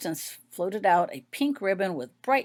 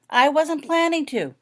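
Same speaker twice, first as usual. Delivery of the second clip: a raised, put-out reply; disgruntled; 9 semitones higher, 7 dB louder than usual. A narrator's reading. Fiona bristling at being told not to help, raised and miffed. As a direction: answer back, a little miffed. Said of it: "came the disgruntled voice of Fiona".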